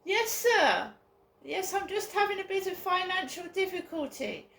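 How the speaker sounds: high, polite put-on posh voice. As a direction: imitate dad sucking up to officials. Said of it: "my dad used to put on a posh voice".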